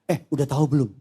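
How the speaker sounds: hammed-up gossip, rising into loud babble; mock gossip. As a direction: spread a rumour in comic blabber. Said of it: "langsung dia W A grup temennya".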